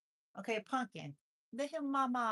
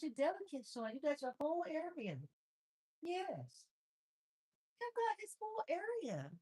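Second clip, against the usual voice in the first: high, sing-song pet voice; 8 semitones higher than usual. Real, not acted. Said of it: "I talk like that 'cause he likes when I make the funny sounds".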